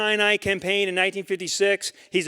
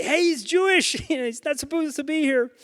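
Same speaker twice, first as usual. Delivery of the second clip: joking blurt; 8 semitones higher than usual. Pretend. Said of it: "so I wouldn't say".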